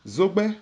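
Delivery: Zogbe said high with a rising tone. Shown as a demonstration. Teacher models the tones of Zogbe for learners. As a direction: lift Zogbe up into a clear rising tone. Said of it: "avec les intonations bien entendu".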